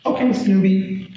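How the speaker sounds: lightly voiced sassy five-year-old; child with attitude. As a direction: imitate a cheeky child talking back. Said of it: "what's up with the attitude".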